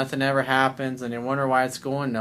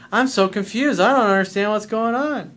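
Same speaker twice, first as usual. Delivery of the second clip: high, loud imitation of a baffled client; pitched-up mock complaint; mock bewilderment; 7 semitones higher, 5 dB louder than usual. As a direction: play up helpless confusion, mockingly.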